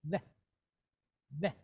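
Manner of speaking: "Ve" with a V and a quick short E. Shown as a demonstration. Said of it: "a V sound and a quick E".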